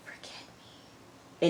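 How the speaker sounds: barely audible whispered line; hushed, unvoiced retelling; imitated whisper. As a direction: whisper it as if beside a sleeper. Retold farewell over a sleeper.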